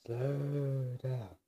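long stretched "slow", easing down; drawn-out self-calming. Real, not acted. Vlogger reining in own fast talk, drawn-out delivery. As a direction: stretch out each word deliberately. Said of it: "Am I talking fast?".

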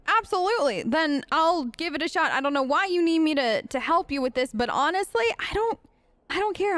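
ordinary delivery

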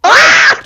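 high loud scream; screaming while falling. Real, not acted. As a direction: yelp in fright mid-fall. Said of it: "Sen niye öyle bağırdın lan".

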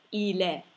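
"il est" joined as one i-le; clear liaison. Shown as a demonstration. Teacher shows learners a liaison, words linked. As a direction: link the L of "il" into "est", silent ST. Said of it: "facciamo una bella liaison".

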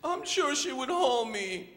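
high little-bird voice; high, drawn-out baby bird; Choco. Acted. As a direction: plead hopefully as a lonely chick. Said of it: "How about you be the voice of Choco?".

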